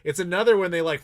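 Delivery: usual speaking voice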